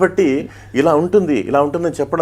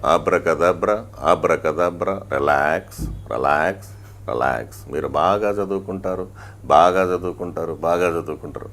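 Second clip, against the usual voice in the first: much lower, slow hypnotist voice; imitating Pattabhiram; 8 semitones lower than usual. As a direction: drop low and slow like a hypnotist calming students. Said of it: "ఆయన వాయిస్ని అలాగే imitate చేశాను". (విద్యార్థులను relax చేసే పట్టాభిరామ్ గారి లోతైన నెమ్మది గొంతు అనుకరణ)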